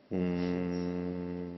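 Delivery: long, slow, low hum of om; humming om. Shown as a demonstration. Teacher demonstrating the om chant to students, long low hum. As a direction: hold a long low om hum to feel the vibration. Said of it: "ओम बोलो और देखो यहाँ vibration अनुभव करो".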